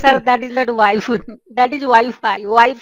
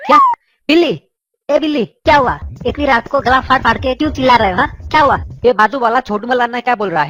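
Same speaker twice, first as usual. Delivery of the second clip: shrill angry wife; faster than usual. Acted. Wife yells at her husband over the late-night call, angry. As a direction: scold him like a furious wife woken at night.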